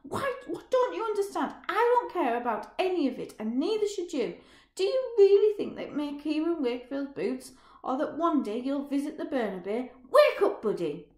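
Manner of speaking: high, loud, with a screamed wake up; raging at his friend's naive dreams; furious shouting. Acted. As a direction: yell it while punching. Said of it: "he shouts".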